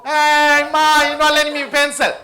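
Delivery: little sibling complaining. Retold or imitated. Little sibling calls to mom about the pencil, whiny loud complaint. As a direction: wail it like a kid tattling to mom. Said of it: "That child will go".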